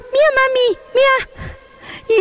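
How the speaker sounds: put-on a toddler proudly showing his drawing. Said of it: "él me decía". (nene orgulloso, voz mucho más aguda)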